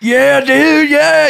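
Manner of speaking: high, loud thrilled fan voice; excited fan. Retold or imitated. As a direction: gush like a starstruck fan. Fan greeting him excitedly.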